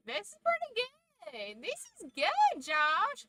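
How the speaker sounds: high, sweet mock praise of bad food; imitating Mama. Mom tasting her own cooking, high cheerful mock voice. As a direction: chirp it up high like a contented mom. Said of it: "She is just like".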